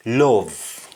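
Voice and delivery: "love" said lohv, with an O vowel; Jamaican pronunciation. Shown as a demonstration. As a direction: say "love" the Patois way, as lov. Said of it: "it's pronounced as".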